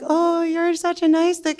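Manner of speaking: high, sweet cooing voice. Retold or imitated. Women coo at the limp dick, high and sweet. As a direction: coo like talking to a pet. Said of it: "We're like".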